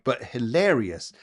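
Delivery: ordinary talk, nothing marked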